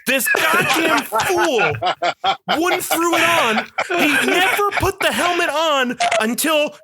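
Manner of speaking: high, heated ranting, half laughing; furious rant. Real, not acted. Podcast host ranting to cohosts, furious and high. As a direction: vent pure outrage at the show. Ranting about Boba Fett helmet.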